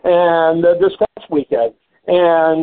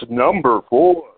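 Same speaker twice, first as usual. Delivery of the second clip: deep, drawn-out radio host read; radio announcer voice; 5 semitones lower, slower than usual. Shown as a demonstration. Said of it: "I need to use my radio voice".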